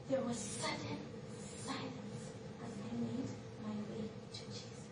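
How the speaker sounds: soft, low, halting delivery; hushed awe. Acted. Woman nearing Jesus in sudden silence, hushed. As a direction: drop to a reverent hush, pausing between phrases. Hush as she approaches Jesus.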